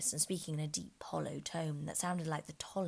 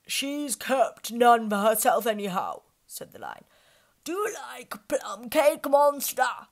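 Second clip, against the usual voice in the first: loud, slow lion voice; 6 semitones higher, 12 dB louder, slower than usual. Acted. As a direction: speak loudly and slowly to her. Lion talks to Alice.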